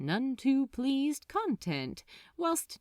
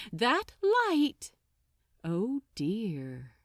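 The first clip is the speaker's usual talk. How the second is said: plain reading voice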